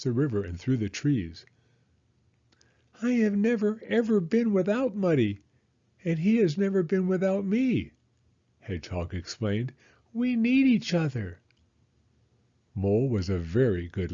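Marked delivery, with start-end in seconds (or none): 3.0-7.9 high small-animal voice, sorrowful; plaintive little Hedgehog. Acted. Hedgehog explaining life with Muddy, high plaintive character voice. 10.1-11.4 very high small-animal voice; earnest, high storybook creature voice; plaintive little Hedgehog. Acted. Hedgehog telling Mole about Muddy, high plaintive character voice. Hedgehog explains her friendship.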